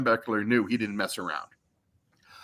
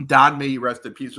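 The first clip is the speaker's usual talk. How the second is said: ordinary talk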